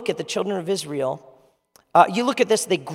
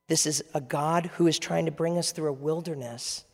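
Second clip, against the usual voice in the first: ordinary talk, nothing marked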